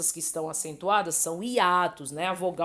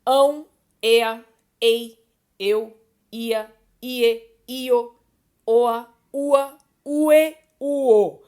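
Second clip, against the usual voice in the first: each ending called out loud and high; spelled-out vowel endings; 4 semitones higher, 8 dB louder, much slower than usual. Shown as a demonstration. Teacher calling out each diphthong ending loud to her students. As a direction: read each ending out slowly for the class. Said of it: "Então, nós teremos".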